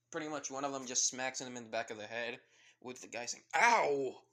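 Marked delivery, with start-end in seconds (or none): 3.6-4.2 loud, high yelp of sudden pain; sudden loud mock ow. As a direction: yelp it as if just smacked. Hero smacked on the head.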